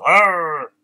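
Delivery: loud imitated lion roar. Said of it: "this lion goes into the forest".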